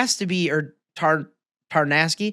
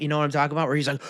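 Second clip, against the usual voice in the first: normal talking voice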